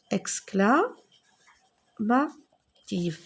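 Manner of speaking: syllabes très étirées; épelle lentement le mot. Shown as a demonstration. (exclamative très lente)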